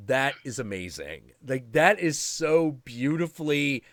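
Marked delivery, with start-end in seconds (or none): none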